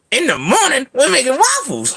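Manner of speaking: high-pitched excited cartoon sidekick; high, zany impression; Donkey. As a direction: announce it in a very high, swooping voice. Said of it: "Blue's donkey".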